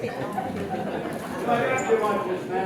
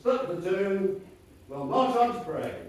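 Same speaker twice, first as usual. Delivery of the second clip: usual speaking voice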